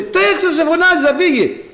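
high, loud urging with a laugh; laughing shout. Retold or imitated. Villagers urging the blind man with a laughing shout. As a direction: yell it out half-laughing, pushing him on. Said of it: "λέει".

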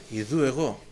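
soft, low here I am; a hushed divine reply; quiet answer. Shown as a demonstration. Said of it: "και ο Κύριος απάντησε".

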